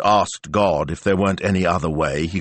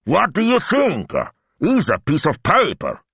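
higher, exasperated at the obvious; scoffing, impatient boss; 12 semitones higher, 3 dB louder than usual. A narrator's reading. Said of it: "he said, impatient".

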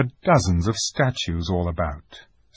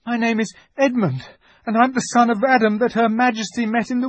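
put-on high, frightened boy's explanation; nervous pleading, faltering at the end; trembling with fear; 13 semitones higher, 6 dB louder than usual. A narrator's reading. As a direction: blurt it out in a high, scared voice. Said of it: "trembling so that he could hardly speak".

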